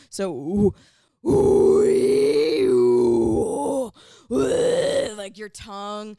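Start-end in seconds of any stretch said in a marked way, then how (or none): 0.1-5.1 loud gritty ooh and ee screams; fry scream vowels. Shown as a demonstration. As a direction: demo scream vowels with mouth shapes. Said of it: "you go with the E".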